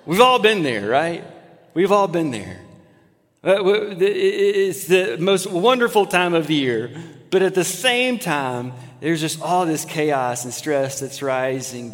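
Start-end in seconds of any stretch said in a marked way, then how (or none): none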